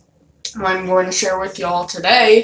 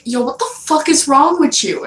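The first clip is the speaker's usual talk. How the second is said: higher-pitched mock indignation; indignant mom; 7 semitones higher than usual. Mom confronting her child after being hit, indignant. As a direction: imitate a mom demanding an explanation.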